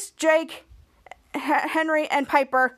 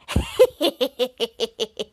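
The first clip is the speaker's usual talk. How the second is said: long string of staccato giggles; acted-out gleeful laugh; mock laughing; 2 semitones lower than usual. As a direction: titter in quick repeated pulses. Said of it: "And she was like".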